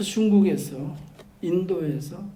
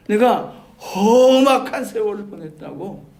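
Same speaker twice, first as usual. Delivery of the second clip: Jacob's lament; 6 semitones higher, 8 dB louder than usual. Acted. Preacher voices Jacob's old-age confession.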